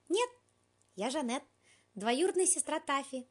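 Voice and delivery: cat disguising himself as cousin Janet; disguised voice. A narrator's reading. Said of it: "ответил изменённым для маскировки голосом".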